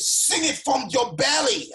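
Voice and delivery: high, drawn-out mock shout; feisty command. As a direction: imitate a fierce choir teacher's high yell. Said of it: "she got feisty with me".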